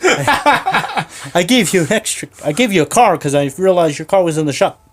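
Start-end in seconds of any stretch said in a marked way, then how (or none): 0.0-2.3 laughing. Real, not acted. Laughing mid-story.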